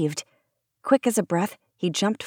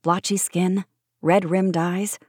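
ordinary delivery, nothing marked

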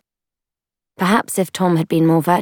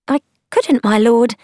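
high, louder female character voice; woman companion's voice; 7 semitones higher, 5 dB louder than usual. A narrator's reading. Woman pretends to refuse the lord's money, high female voice. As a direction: protest in a raised female voice.

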